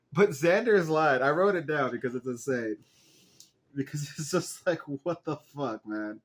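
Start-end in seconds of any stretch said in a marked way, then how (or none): none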